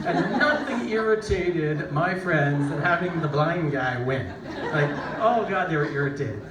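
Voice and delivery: chuckling through the words; amused, laughing delivery. Real, not acted. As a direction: laugh as you tell it. Laughing while telling friends' irritation.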